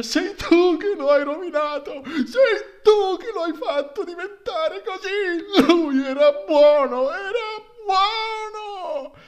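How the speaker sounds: high, loud shriek with a crying laugh. Acted. Young man shrieking at the widow, loud and high, with a sob. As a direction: scream it into her face, half sobbing. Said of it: "le strillò in faccia".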